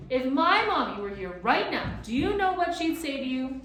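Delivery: high loud indignant challenge; upset girl. A narrator's reading. Girl challenging the gaboons, angry. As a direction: demand it, pushed too far. Girl pushed too far.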